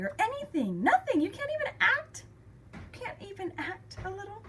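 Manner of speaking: very high, incredulous protest; exasperated outburst. Retold or imitated. Daughter confronting dad who ignores her baby, exasperated.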